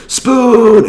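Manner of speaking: high, loud, drawn-out crowd yell; imitating an audience shouting in unison. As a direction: holler it like a rowdy movie crowd. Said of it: "everybody in the audience would go".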